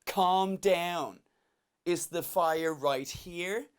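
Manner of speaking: drawn-out, exasperated mock complaint; imitated annoyed woman. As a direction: stretch it out, hammed-up irritation. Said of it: "she's so annoyed".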